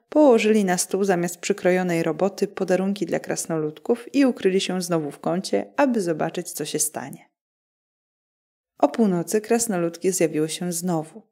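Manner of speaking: usual speaking voice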